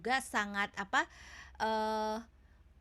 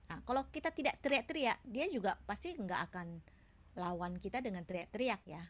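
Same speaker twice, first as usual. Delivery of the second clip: usual speaking voice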